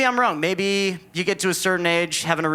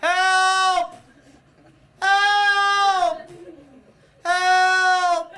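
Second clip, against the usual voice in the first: mock yelling for help; 14 semitones higher, much slower than usual. Comedian mimics the man down the hall, high mock yells. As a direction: imitate a patient calling out for help down the hall. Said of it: "a guy down the hall who just yelled".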